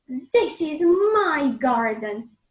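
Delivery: loud, slow and stern; angry giant. Acted. Giant claiming his garden from the kids, stern and loud. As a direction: lay down the law to the children. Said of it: "says the giant".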